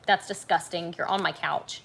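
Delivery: usual speaking voice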